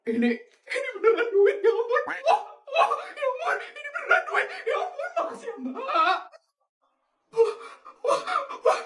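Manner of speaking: high, squealing joy; ecstatic squeal. Acted. Woman shown the money for her place, squealing delight. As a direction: squeal over the cash.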